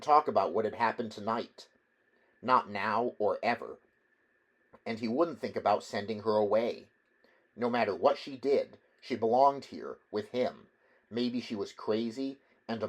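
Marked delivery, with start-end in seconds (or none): none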